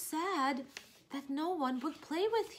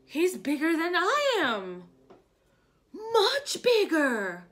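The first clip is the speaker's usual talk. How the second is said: higher, louder, slow marvelling cry; excited Piggy; 6 semitones higher, 8 dB louder, slower than usual. Acted. Reading to kids, Piggy amazed at the big elephant. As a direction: gasp it out like an amazed little pig.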